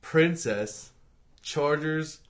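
slow, with a pause after each chunk; slow, enunciated. Pretend. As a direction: announce the name piece by piece, deliberately. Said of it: "Say it slower".

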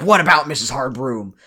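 lightly voiced sinister teacher; a hint of a lurking strict teacher; teacher catching gossiping girls, lightly sinister; creepy teacher voice. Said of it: "She's like".

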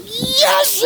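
high crying shout of joy; victory shout. Retold or imitated. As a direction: let out a winning yell. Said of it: "And I'm like".

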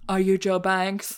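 slow, mannered mock-serious voice; a campy heiress, drawn out; imitating Angelica. Mocking Angelica's line to Joe, slow and mannered. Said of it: "She talks like this".

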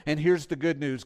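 usual speaking voice

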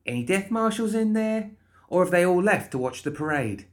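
high, insistent interrogator; pushy, inquisitive Molly. Acted. Molly pressing the guard about death marshals, insistently.

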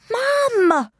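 high, panicked call; panicking. Acted. Child calling Mum as footsteps descend, panicking. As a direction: cry out to Mum in alarm. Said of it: "I was panicking now".

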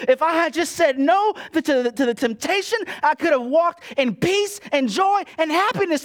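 high, loud, fervent preaching; preaching at full force. Acted. Preacher confessing guilt to the church, high and loud. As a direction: preach the shame with rising fire.

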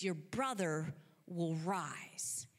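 low, slow, hushed promise; Jesus. Acted. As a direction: reassure gently, letting each word settle. Jesus solemnly promises Martha.

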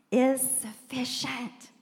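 slow, weighty delivery; lightly voiced solemn answer; slow God voice. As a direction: draw each word out deliberately. Voicing God's answer.

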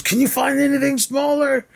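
pitched far up, mock incredulous; hammed-up sarcasm in a squeaky voice; high sarcastic voice. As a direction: whine it up high like a sarcastic cop. Acted quote, sarcastic movie line.

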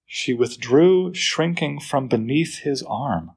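slow, hushed read; slow poem reading. Acted. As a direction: read it as if tiptoeing.

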